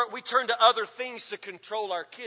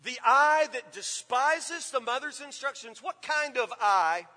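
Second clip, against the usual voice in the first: slow and louder, pushing the word eye; slow emphatic reading; 2 semitones higher, 4 dB louder than usual. Shown as a demonstration. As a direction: preach the verse word by word. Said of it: "What are we talking about here?".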